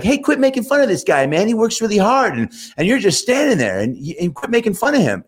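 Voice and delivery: mock the tiny boss with a shrill high-pitched whine; imitating a drunk little leprechaun boss. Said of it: "he had a super high leprechaun voice".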